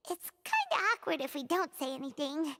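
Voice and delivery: ordinary delivery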